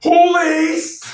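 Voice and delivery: very high, drawn-out, loud whoop; hyped exclamation. Real, not acted. Guest reacting to the tour question, hyped exclamation. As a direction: burst out in stretched-out excitement.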